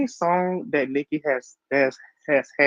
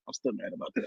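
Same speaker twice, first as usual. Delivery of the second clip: much softer, muttered repeat; quiet repeat; 6 semitones lower, 14 dB quieter, much faster than usual. Real, not acted. Friend mutters her grudge again to cohost. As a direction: drop to a sulky under-breath.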